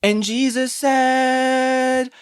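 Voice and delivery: high, very slow sing-song sermon; sing-songy preacher. Retold or imitated. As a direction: drag it out like a pompous preacher. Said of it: "they do this weird sing-songy voice".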